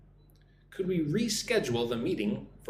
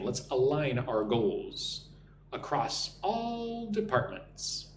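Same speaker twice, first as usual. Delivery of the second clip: slow, stretched words with a pause mid-sentence; slow repeat-after-me model; slower than usual. Shown as a demonstration. Teacher modelling the sentence after explaining it, slow and deliberate. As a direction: draw the sentence out phrase by phrase. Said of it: "Listen and repeat after me".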